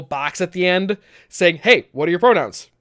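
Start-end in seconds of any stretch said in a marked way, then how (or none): none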